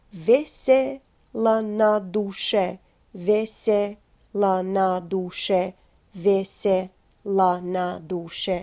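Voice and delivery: Vesela na duše joined, slow and even; медленно, целой фразой; slow whole phrase. Shown as a demonstration. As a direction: link the phrase slowly. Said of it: "speak them in the context of each phrase".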